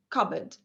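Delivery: CUPBOARD as "CUB-id", no p; weak second syllable. Shown as a demonstration. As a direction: reduce the "oard" of "cupboard" to a short "id". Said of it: "it is id".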